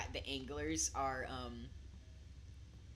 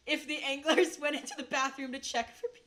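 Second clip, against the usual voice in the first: high, loud and breaking into a laugh; amused laughing; 8 semitones higher, 5 dB louder than usual. Real, not acted. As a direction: crack up mid-sentence at your own evil idea. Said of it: "I shouldn't laugh at that".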